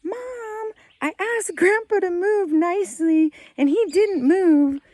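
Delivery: put-on high, loud kid complaint; imitating upset child. Son complaining to mom about Grandpa, high loud upset whine. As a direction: wail it to Mom like a wronged child. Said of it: "like, upset".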